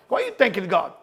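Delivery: usual speaking voice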